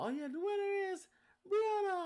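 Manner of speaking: calling out the winner in a high voice; mock announcer.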